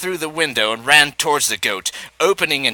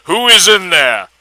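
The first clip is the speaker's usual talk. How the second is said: bold deep voice; 5 semitones higher, 7 dB louder than usual. A narrator's reading. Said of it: "asked boldly in a deep voice".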